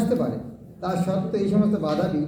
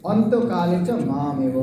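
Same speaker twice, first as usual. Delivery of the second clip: measured, slow chant of the verse; slow reverent verse recital; slow Sanskrit recitation; 4 semitones lower, slower than usual. Shown as a demonstration. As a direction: intone the verse slowly, as scripture. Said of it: "তো উত্তর কৃষ্ণ কী দিচ্ছেন?".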